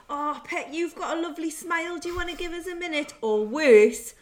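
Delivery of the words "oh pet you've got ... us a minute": high, fast, wheedling chat-up line; imitating a lad chatting up a passer-by; mock chatty lad. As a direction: pitch it up and rattle it off like a cheeky lad. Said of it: "and they go".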